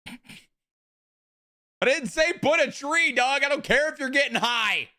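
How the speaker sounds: very high-pitched, drawn-out scolding; mock outraged yell.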